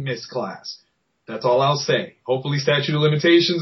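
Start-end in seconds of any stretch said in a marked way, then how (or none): none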